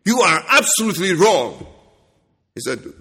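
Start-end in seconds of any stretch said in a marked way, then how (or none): 0.0-1.7 defiant rebuke. Pretend. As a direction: correct him with mock politeness. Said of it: "I'll say to him".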